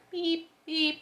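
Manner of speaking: tow truck reverse beep, pitched high. Retold or imitated. Mimicking a tow truck backing up at night, high beeps.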